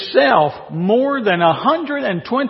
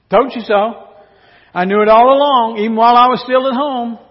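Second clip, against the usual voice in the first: louder, smug gloat, rushed at the end; smug prophet rubbing it in; gloating I-told-you-so; 5 semitones higher, 6 dB louder, faster than usual. Acted. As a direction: crow it over God like a know-it-all. Jonah gloating at God.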